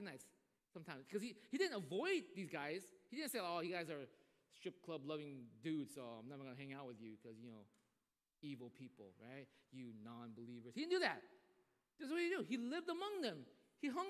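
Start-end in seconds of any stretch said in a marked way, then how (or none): 3.4-10.7 mock self-righteous. Mocking self-righteous refusal.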